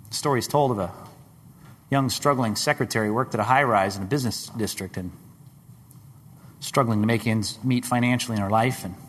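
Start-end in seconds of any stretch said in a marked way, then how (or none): none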